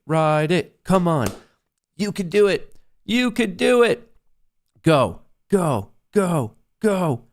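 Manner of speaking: high, pleading cheer for the trade; begging the price to move; excited urging. Real, not acted. Urging his trade to fill.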